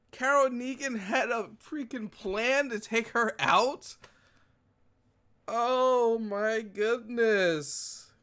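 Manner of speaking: high, slow, awed exclamations; amazed. Real, not acted. Viewer reels from the plan reveal, high and amazed. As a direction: let the amazement sink in slowly.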